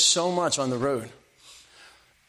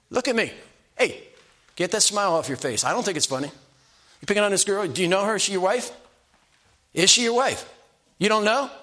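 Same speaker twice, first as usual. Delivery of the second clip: higher, sharp challenging questions; stern confrontation; 5 semitones higher than usual. Retold or imitated. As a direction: call the bully out and demand answers. Confronting a bully yelling at girl.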